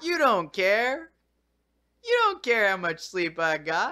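talking to the dog. Real, not acted. Complaining to the dog.

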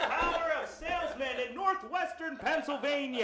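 big, high shouted sales pitch; laughing mock rally shout; hammed-up salesman speech. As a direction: bellow it like a motivational speaker rallying salesmen.

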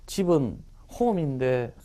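softly thinking aloud, searching for the word; puzzled muttering. Retold or imitated. Student trying to recall "go home" in English, puzzled muttering. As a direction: act a learner groping for a word, half under the breath. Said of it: "이런 식으로 생각해요".

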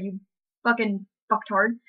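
usual speaking voice

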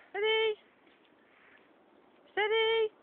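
high, loud warning call; telling the dog to steady. Real, not acted. Dog bounding too fast.

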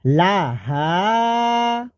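লাহা with the long a drawn out very slowly; stretched long vowel. Shown as a demonstration. Teacher showing the one-alif stretch in লাহা. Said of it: "এক আলিফ টান হবে।".

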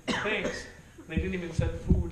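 sarcastic thanks through laughter; laughing mock thanks. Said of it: "You'd read it".

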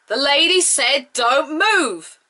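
high-pitched put-on chauffeur voice; Parker. Chauffeur warning a man not to turn around, put-on voice. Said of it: "Gotta love Parker".

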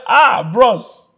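high, loud mock astonishment; startled acted greeting. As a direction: exclaim as if stunned to see him. Acts John startled at seeing Jesus.

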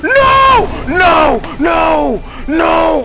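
high frantic shouts; frantic shouting. Real, not acted. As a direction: yell urgently at the screen. Frantic game commands.

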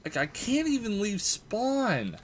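high complaining rant; complain, stuck and helpless; exasperated rant. Real, not acted. Gamer stuck in spawn, high exasperated rant.

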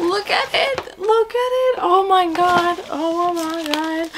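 high, tearful squeal of delight; squeal, close to tearing up; overwhelmed excitement. Real, not acted. Book fan seeing her surprise copy, overwhelmed squeal.

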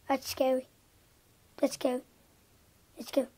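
scary voice. Pretend. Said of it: "that's my scary voice".

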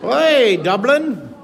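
a failed stab at Irish, sounds like a Nebraska sailor; Irish accent. Retold or imitated. Greeting Dublin viewers in a badly faked Irish accent. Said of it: "so I sound like a, a sailor from Nebraska".

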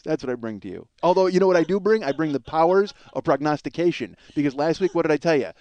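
ordinary delivery

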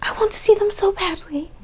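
high, breathy longing whisper. Real, not acted. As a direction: whisper it like a wish you can't help. Longing to see the band.

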